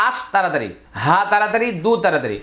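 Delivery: ordinary talk, nothing marked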